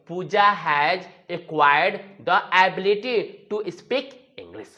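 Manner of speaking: very slow, word by word; slow dictation. Shown as a demonstration. Teacher modelling an English sentence, very slow. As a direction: spell the sentence out slowly for learners. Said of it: "तो क्या बोलेंगे?".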